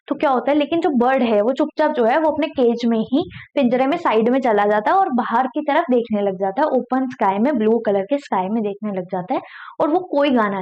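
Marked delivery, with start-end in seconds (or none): none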